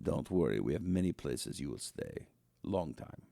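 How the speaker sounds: low, quiet and sinister, a mock official; deep hushed menace, long time; menacing interrogator. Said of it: "And the guy goes".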